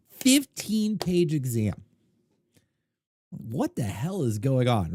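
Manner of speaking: normal talking voice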